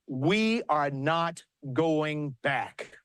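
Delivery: each word spaced out, very slow; slow rally slogan. Acted. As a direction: land the slogan slowly, word by word. Rally slogan in convention speech.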